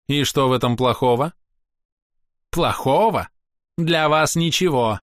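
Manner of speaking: high, proud ringing voice; a scornful elf lecturing strangers; haughty elf. A narrator's reading. Castiel continues.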